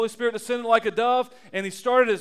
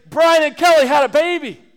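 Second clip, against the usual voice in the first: higher, louder, cheery announcer voice; grandparents announcing; 4 semitones higher, 8 dB louder than usual. Retold or imitated. Grandparents announcing the baby on Facebook, loud and cheerful. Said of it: "they say".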